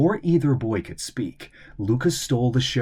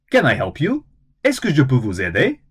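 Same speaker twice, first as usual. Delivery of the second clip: rapid-fire clerk, louder; lightning fast; 4 semitones higher, 6 dB louder, faster than usual. Acted. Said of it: "both lightning fast".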